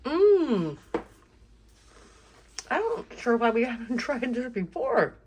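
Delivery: talking around a bite, chewing; chewing while talking. Real, not acted. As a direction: mouth full of food. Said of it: "Sorry, I'm chewing".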